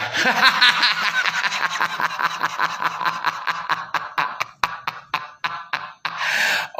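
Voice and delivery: long, real cackle trailing into breathy wheezes; laughing. Real, not acted. Commenter can only laugh at the lawsuit, long laughing fit. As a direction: laugh helplessly, on and on. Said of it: "ketawa dan se".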